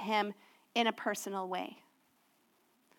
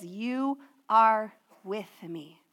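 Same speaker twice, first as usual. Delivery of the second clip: drawn out, weighing each word; slow, deliberate quote; 2 semitones lower, much slower than usual. Acted. Pastor stressing the psalm's centre to the church, very slow. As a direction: lay the words down slowly and reverently.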